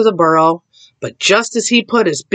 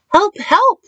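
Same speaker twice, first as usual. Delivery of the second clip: very high cat cry for help; meowing cat. A narrator's reading. Cat calling out for help, high meow. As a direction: mew it high, as a frightened cat. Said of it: "meowed Cat".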